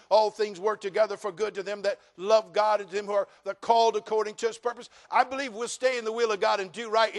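loud, high conviction; fervent preacher declaration; preaching at full force. Acted. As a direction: proclaim it to the church.

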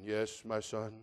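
low, soft voice of God; voice from heaven. Acted. God answering man on the cliff, voice from heaven. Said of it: "a voice comes from heaven".

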